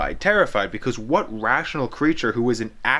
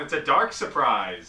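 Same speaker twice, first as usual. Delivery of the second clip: ordinary talk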